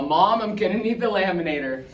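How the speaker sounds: loud, high, excited announcement; thrilled. Acted. Boy calling his mom about the letter, thrilled. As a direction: burst out to Mom with the big news.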